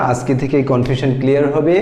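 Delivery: normal talking voice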